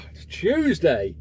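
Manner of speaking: normal talking voice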